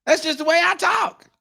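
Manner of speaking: mock yelling, very high and loud; hammed-up high-pitched yell; Anna Kasparian. Imagined host greeting someone she just met, mock yelling. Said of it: "I expected her to yell at me".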